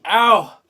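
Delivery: sharp yelp of pain; loud ow of burning pain. Real, not acted. Sudden pain from chip.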